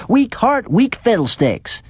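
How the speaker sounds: high, scornful shriek of weak heart; fierce scorn. A narrator's reading. Mrs Abbott mocking the doctor, fierce and high. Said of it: "dreadful fierce to Missus Sam Abbott".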